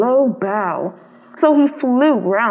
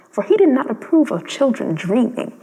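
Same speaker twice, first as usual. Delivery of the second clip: usual speaking voice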